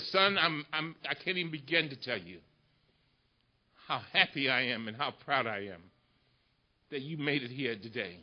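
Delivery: very low, hushed father's voice; retold tender father. Father confiding pride to his congressman son, hushed and low. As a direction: drop right down, soft and halting. Retells tearful father speaking to him.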